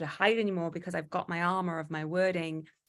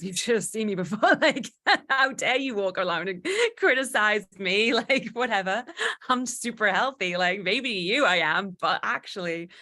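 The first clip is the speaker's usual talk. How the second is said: loud, high laughing comeback; hammed-up outrage breaking into laughter; mock indignation; 4 semitones higher, 8 dB louder than usual. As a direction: protest loudly while cracking up.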